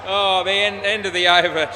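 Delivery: loud call of the end of the over. Real, not acted. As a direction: announce it loudly across the field.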